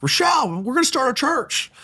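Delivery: high, eager burst of news; lightly acted excited husband; excited announcement. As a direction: blurt it out thrilled. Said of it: "I'm like".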